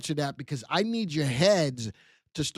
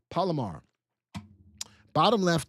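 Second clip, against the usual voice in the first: normal talking voice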